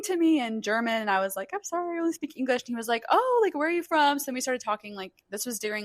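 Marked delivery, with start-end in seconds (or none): 1.5-2.6 quick, higher-pitched apology; retold apology. Tourist to a German stranger, quick higher-pitched apology. 3.1-4.2 higher-pitched curious question; retold friendly interest. German concertgoer to a foreign visitor, retold interested question.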